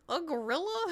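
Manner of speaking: high, laughing question; laughing disbelief. Real, not acted. Repeating the family tease, she laughs the question up high. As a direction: laugh through the word in disbelief.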